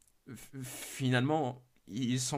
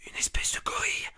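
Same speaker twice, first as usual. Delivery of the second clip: frantic whisper that cracks up high; whispering fast in terror, voice slipping out; scared whisper, breaking into higher voiced bits; panicked whisper; 8 semitones higher than usual. Reports the monster, hiding.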